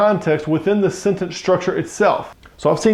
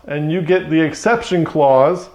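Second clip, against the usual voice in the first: usual speaking voice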